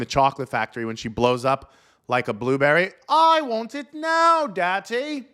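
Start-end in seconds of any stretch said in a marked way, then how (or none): 3.1-5.3 put-on high, slow whiny spoiled-girl voice; spoiled brat girl. Comic mocks a pundit as a bratty rich girl, high and whiny. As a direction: whine it like a bratty little girl. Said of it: "looks like the little spoiled girl".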